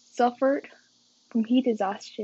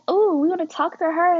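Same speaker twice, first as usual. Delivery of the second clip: put-on higher, louder eager boys; excited coworkers, raised and loud; 6 semitones higher, 7 dB louder than usual. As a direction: voice the guys all hyped to talk to her. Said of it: "they're like".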